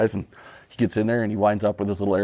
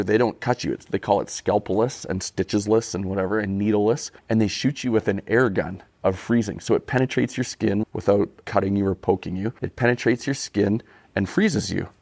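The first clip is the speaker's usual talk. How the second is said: ordinary talk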